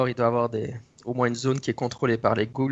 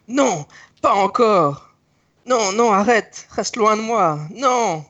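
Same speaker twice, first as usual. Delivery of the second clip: high, slow, panicked cries; screaming in terror; 8 semitones higher, 3 dB louder, much slower than usual. Acted. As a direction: scream for mercy, terrified. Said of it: "vous entendez des cris à l'intérieur".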